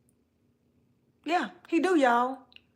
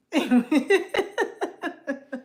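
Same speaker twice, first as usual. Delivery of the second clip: high giggle; 5 semitones higher, 4 dB louder than usual. Real, not acted. Laughing at own flirting.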